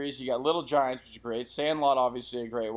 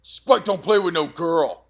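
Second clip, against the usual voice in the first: slow, loud, higher tough-guy voice; hammed-up Spike from Little Giants, slow and loud; tough kid quote; 5 semitones higher, 10 dB louder, slower than usual. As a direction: bark it like the movie bully. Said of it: "the best quote of all time".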